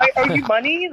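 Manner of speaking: laughing through a mock worried question; a laughing, half-joking question. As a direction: giggle as you play scared.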